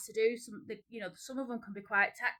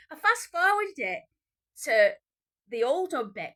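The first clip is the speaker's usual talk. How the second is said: excited; 6 semitones higher, 9 dB louder than usual. Real, not acted. Indignant retelling.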